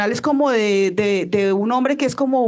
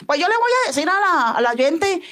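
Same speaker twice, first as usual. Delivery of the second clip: mimicked radio caller; 7 semitones higher than usual. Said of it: "hablaba una de las voces por allá, de las que son imitación".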